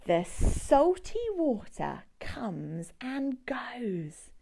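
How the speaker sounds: slow hushed whisper. Acted. Whispering to children about the waves. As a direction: breathe the words out slowly.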